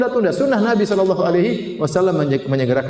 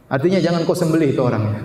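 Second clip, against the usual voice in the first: usual speaking voice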